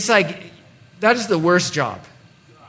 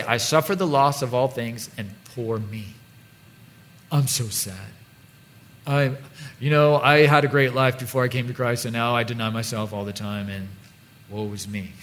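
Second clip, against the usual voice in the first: softer, sinking mock whine of self-pity; 6 semitones lower, 5 dB quieter than usual. Preacher mocking a self-pitying believer, fading to a soft mock whine. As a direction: play up a hammed-up poor-me sigh. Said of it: "he doesn't say".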